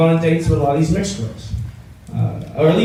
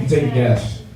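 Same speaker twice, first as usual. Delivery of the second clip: usual speaking voice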